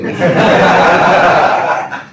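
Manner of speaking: big open laugh; loud laugh. Real, not acted. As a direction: burst out laughing. Laughs at lying student. (tertawa lepas)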